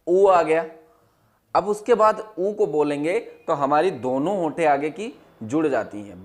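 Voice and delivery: normal talking voice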